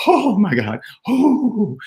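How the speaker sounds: high, gushing mock gasp of delight; over-the-top high-pitched thrilled squeal; wildly excited wife. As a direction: imitate a wife losing her mind with excitement. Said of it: "losing her mind 'cause she's so excited".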